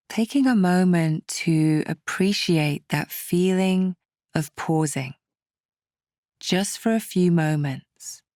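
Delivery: calm, unhurried meditation guide, with long pauses; guided meditation. Acted. Meditation teacher settling listeners to calm political worries, calm and measured. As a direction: ease the listener gently through each step.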